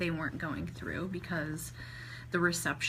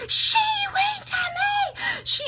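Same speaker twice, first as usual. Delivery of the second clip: piercing, drawn-out fangirl scream; 10 dB louder, slower than usual. Retold or imitated. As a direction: shriek it in ecstatic disbelief, very high and loud. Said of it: "screaming it so loud".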